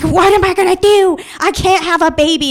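high, loud mock freak-out; retold panic. Woman retelling her freak-out over a possible baby, panicked. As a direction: voice shoots up in acted panic. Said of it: "just in full, like, fugue state".

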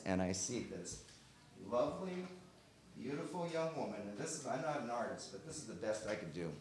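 hushed. Real, not acted. Describing the woman he saw, soft and awed. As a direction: hush as if seeing an angel. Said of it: "hushed memory of vision".

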